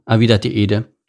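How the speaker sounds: usual speaking voice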